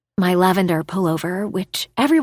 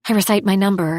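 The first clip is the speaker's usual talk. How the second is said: ordinary delivery, nothing marked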